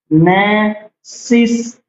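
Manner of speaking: ने सिस broken into slow separate syllables; very slow syllabified ने सिस. Shown as a demonstration. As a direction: chop ने सिस apart slowly for dictation. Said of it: "double S आ रहा है".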